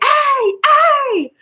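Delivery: very high, loud birdlike hey hey; squawked jay-style hey hey; blue jay call. Retold or imitated. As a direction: imitate a blue jay's call. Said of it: "that's how they sound".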